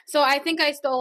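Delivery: ordinary delivery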